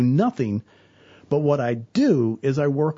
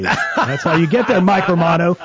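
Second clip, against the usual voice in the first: louder, laughing jab at the co-host; teasing laugh; 4 semitones higher, 7 dB louder than usual. Real, not acted. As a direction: rib him through a laugh. Teasing co-host about football.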